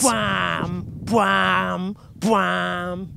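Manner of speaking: three long imitated brass bwams. Comedian mimics the Inception soundtrack, sustained horn noises. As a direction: imitate booming film-trailer horns, each held. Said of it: "doing the [name] soundtrack".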